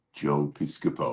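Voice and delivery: dreamy slow-motion name. Retold or imitated. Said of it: "Slow motion".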